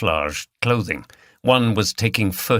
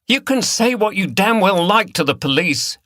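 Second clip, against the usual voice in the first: loud, high, defiant; 10 semitones higher, 4 dB louder than usual. A narrator's reading. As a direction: fling it back at him. Liz shouts defiance.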